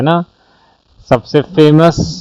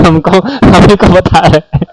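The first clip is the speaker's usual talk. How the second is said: laughing through the words; giggling while talking; 6 semitones higher, 6 dB louder than usual. Real, not acted. Laughing at a comment.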